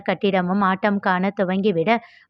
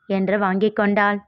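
ordinary delivery

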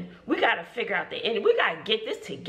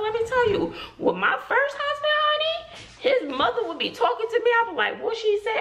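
animated high pitch; 12 semitones higher than usual. Retold or imitated. Animated aside about mother-in-law.

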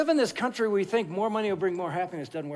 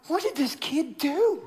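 slow, laughing disbelief; alarmed; 8 semitones higher, slower than usual. Retold or imitated. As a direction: act baffled and worried, slowly. Pastor's alarm at the sheriff.

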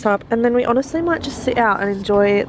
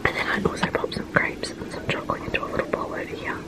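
hushed whisper so Dad won't hear; 5 dB quieter than usual. Real, not acted. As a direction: whisper it, keep it secret. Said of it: "I have to whisper because Dad's in the other room".

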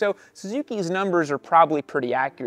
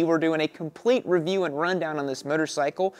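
ordinary delivery

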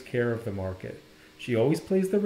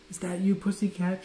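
lightly voiced drowsy grandma, higher; a touch of sleepy, higher-pitched grandma; 6 semitones higher, 3 dB quieter than usual. A narrator's reading. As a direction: answer as if just waking up. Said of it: "answered in a sleepy voice".

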